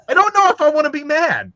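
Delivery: high, loud bewildered cry; stunned disbelief. Retold or imitated. Telling friends about the odd customer, high stunned disbelief. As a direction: torn between laughing and fuming. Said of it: "And I was like".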